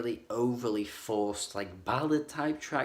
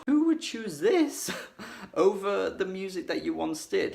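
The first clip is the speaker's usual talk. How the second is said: much higher, louder, laughing through the question; laughing disbelief; 9 semitones higher, 6 dB louder than usual. Real, not acted. Reviewer laughing at the band's choice, incredulous.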